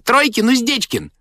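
high-pitched nursery chorus; very high, piping child's call; children shouting. A narrator's reading. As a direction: shout guesses like an excited child. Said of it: "кричали из детской".

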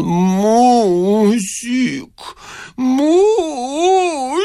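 long, high, chanted calling; sing-song call. A narrator's reading. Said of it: "певуче призывал". (певучий протяжный призыв)

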